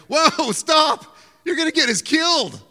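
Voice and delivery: a sudden high, loud yell of alarm at the driver; panicked shout. Retold or imitated. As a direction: shout in fear as the car backs into traffic. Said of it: "I'm like".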